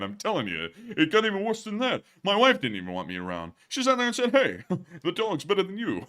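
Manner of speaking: hammed-up stand-up complainer with swooping pitch; no-respect comedian, exasperated. Answering a viewer's dog joke, exasperated no-respect comedian. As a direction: laughingly lament like a hard-done-by comic.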